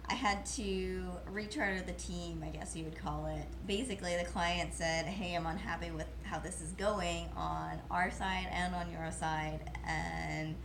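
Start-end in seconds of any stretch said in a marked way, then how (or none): none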